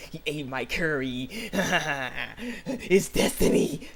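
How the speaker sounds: slow, hammed-up gloating with laughter; obsessed girl. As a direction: draw it out and cackle over destiny. Acting out obsessed girl's gloating.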